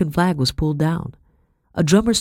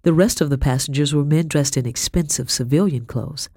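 ordinary delivery, nothing marked

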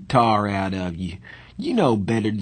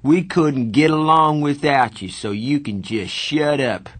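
fierce but fond scolding; raised-voice reassurance; 5 semitones higher, 4 dB louder than usual. A narrator's reading. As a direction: insist forcefully, starting high and loud. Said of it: "Two-Bit said fiercely".

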